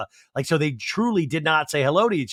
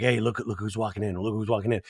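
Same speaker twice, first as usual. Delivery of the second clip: low mocking guys; 5 semitones lower, 5 dB quieter, faster than usual. Guys noticing women enter.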